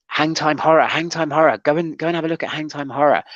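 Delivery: imitating an eager friend. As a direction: sell it like a friend who won't stop recommending. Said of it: "he was very much".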